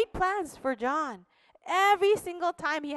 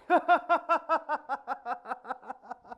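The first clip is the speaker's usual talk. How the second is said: mocking laugh.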